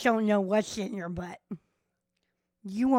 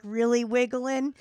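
ordinary delivery

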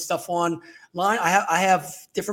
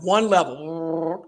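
slow, flat, one-level delivery; monotone; 2 semitones lower, much slower than usual. Shown as a demonstration. Showing how script readers sound on the phone, slow monotone. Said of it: "you're just monotone".

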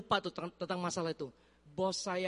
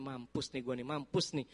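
lower, much softer, muttering doom; quiet inner dread; inner panic; 7 semitones lower, 7 dB quieter than usual. Retold or imitated. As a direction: mutter under breath that you're done for. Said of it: "dalam hati saya".

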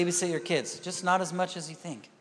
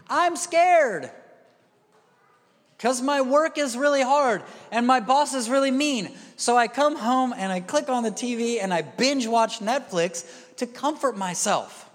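high, loud complaining voice; whiny stressed worker; 6 semitones higher, 5 dB louder than usual. Retold or imitated. Worker excusing binge watching Netflix, whiny and high.

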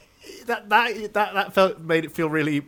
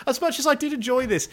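ordinary delivery

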